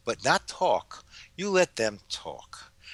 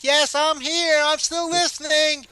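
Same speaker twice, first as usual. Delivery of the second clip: high, loud call from another room; yelling back; 8 dB louder, slower than usual. Pretend. Pretending to yell back at the debt collector from her chores, loud and high. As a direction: shout it across the house, cheerfully busy. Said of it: "I'll yell back".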